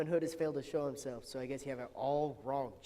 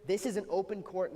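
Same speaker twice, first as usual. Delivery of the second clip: loud raised order call; 6 semitones higher, 6 dB louder than usual. Acted. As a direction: bang the gavel with your voice. Judge calls court to order.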